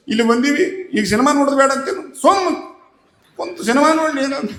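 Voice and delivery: a fairly loud, heated retold rant; angry husband. Husband fuming at his wife in the theatre, heated rant. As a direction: act out the husband losing his temper. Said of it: "ಅವಂಗ ಸಿಟ್ಟು ಬಂತು ನೋಡ್ರಿ".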